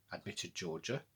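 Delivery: usual speaking voice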